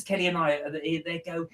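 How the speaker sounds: ordinary talk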